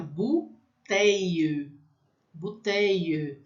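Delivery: bouteille slowly, ou as u, long i end; French bouteille. Shown as a demonstration. Teacher drilling bouteille, slow French. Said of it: "fica um I comprido".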